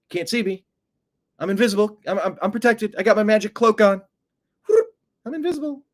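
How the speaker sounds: high, sing-song mocking brag; mock childish boast. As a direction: taunt like a kid hiding under a cloak. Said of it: "trying to cloak your".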